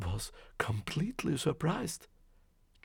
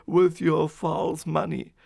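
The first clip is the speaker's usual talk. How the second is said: loud, aggrieved outburst; loud complaint; 10 dB louder, slower than usual. A narrator's reading. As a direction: blurt out the grievance at full volume. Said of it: "the man complained".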